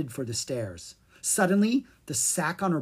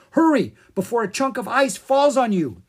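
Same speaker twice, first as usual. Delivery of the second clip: high, loud, urgent troll guard voice; 9 semitones higher, 8 dB louder than usual. Acted. Guard hurrying the children inside, loud and urgent. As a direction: hurry them in before the ice falls.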